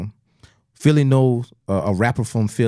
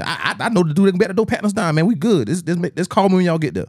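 lightly voiced higher-pitched hustler; fixer reassuring; 7 semitones higher than usual. Fixer telling friends to call on arrival, lightly acted reassurance. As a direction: play the guy who has it handled, lightly.

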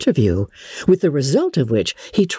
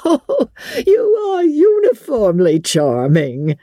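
higher, slow, hammed-up gushing compliment; a clumsy suitor gushing higher and slower; awkward gallantry; 12 semitones higher, slower than usual. A narrator's reading. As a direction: fawn over her clumsily and slowly. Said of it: "cried he, with an air of awkward gallantry".

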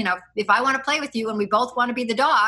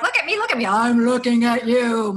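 lion villain voice; 4 dB louder than usual. Retold or imitated. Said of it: "I can't even do that accent".

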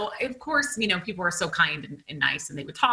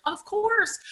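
very high-pitched, agreeable quote; lightly mocked high-voiced helpfulness; imitated obliging reply. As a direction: mimic an accommodating cashier way up high. Acting out the cashiers' obliging reply.

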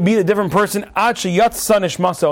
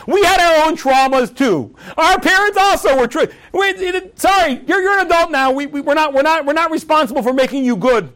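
flustered parents; 8 semitones higher than usual. Retold or imitated.

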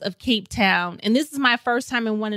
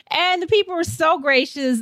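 usual speaking voice

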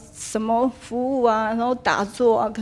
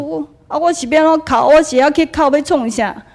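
higher, louder exasperated question; 4 semitones higher, 8 dB louder than usual. Retold or imitated. Speaker frustrated with the master, higher and louder. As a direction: demand an answer in frustration.

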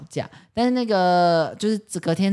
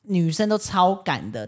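usual speaking voice

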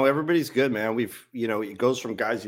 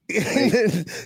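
a drawn-out Australian "Mate", much higher and louder; 13 semitones higher, 5 dB louder than usual. Pretend. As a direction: sing out "Mate" high and loud in an Australian accent. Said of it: "Ma-, mate".

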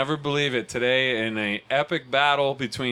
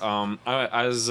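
usual speaking voice

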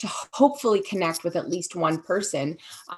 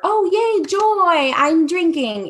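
sarcastic bright cheer, pitched much higher; mock cheer; 10 semitones higher, 4 dB louder, slower than usual. Recalling how drinking never felt, mock joyful. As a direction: fake delight, squealed high and a bit louder.

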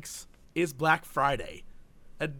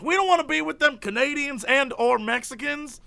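higher-pitched mock complaint; mock indignant; 7 semitones higher than usual. Mimicking angry Southerners fleeing to South America, mock indignant. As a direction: voice an indignant refusal, hammed up.

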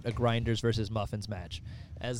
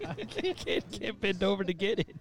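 high, breaking up with laughter; laughing; 8 semitones higher, 5 dB quieter than usual. Real, not acted. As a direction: talk through helpless giggles. Laughing too hard to bend.

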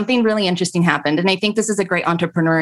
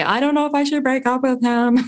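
higher, pleading worried friend voice; whiny friend; 7 semitones higher than usual. Retold or imitated. Friend on the phone asking about a breakup, pitched-up fretting. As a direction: play the fretful caller, pitched up. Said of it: "call me up on the phone and say".

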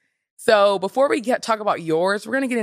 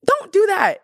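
higher and louder, telling them off; firm scold; 13 semitones higher, 6 dB louder than usual. Real, not acted. Scolding people who mock stutterers, sharp loud order. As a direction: shout it down, stop that now.

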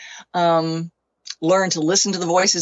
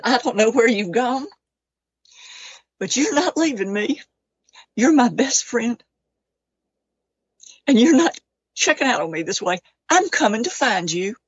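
raised, halting vow to the dead; pleading but determined, pitched up; close to tears; 4 semitones higher than usual. Retold or imitated. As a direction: retell a grieving vow, pausing between phrases. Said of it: "if I cry, I'm sorry".

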